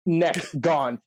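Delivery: ordinary talk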